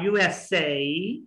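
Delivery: slow U S A as "you-es-say"; drawn-out U S A for copying; say after me. Shown as a demonstration. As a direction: stretch and link U S A. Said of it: "try to say after me".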